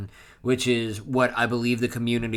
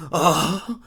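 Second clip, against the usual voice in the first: high, loud shocked "oh"; disbelief; 6 semitones higher, 6 dB louder than usual. Retold or imitated. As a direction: imitate an aghast gasp of oh. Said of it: "Chopper is in disbelief".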